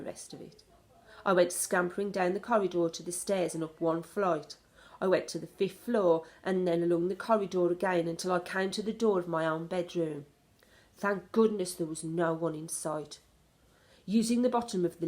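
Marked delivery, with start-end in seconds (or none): none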